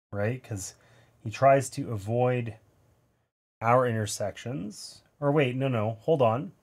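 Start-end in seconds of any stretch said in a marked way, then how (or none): none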